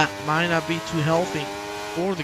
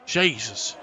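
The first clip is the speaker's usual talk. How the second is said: ordinary delivery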